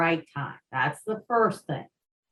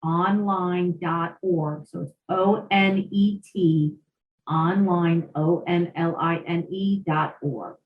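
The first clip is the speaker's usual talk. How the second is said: slow, measured web address read-out; unhurried, letter by letter; a little slower. Shown as a demonstration. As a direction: spell it out slowly for someone writing it down. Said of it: "I'll repeat that a little slower".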